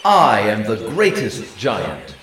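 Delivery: loud, slow giant roar. A narrator's reading. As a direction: roar it with fury. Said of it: "he roared".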